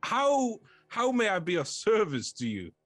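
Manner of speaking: high, theatrical, effusive welcome; lonely stone head, thrilled to have company; lonely living fort. Acted. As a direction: greet rare visitors in a grand character voice. Game master plays the fort.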